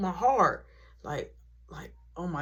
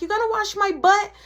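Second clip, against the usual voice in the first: put-on kid brother voice; 11 semitones higher, 6 dB louder than usual.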